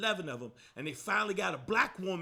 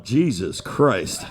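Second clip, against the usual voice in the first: deep slow groan; 13 semitones lower, 7 dB louder, much slower than usual. Retold or imitated. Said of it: "is watching me going".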